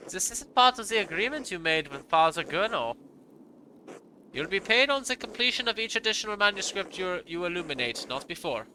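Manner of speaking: put-on stern German-accented monk. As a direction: refuse formally in a German accent. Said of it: "I gave him a German accent".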